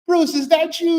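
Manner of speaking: squeaky high, half asleep and surprised; a high wary call at the door; high startled question. Retold or imitated. Woman woken by the late-night knock, high startled question. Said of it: "You all be like".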